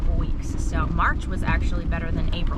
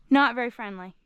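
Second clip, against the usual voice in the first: ordinary talk, nothing marked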